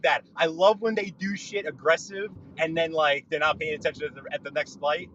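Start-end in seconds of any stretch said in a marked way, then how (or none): none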